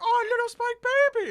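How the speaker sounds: put-on high, loud baby talk; cooing reveler. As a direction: squeal it at a cute baby. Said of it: "he's like".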